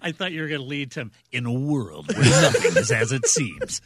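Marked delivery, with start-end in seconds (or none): 1.3-3.8 low, loud epic trailer narrator; movie trailer voice. Retold or imitated. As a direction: boom it out like a blockbuster trailer. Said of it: "Welcome to my epic movie trailer voice".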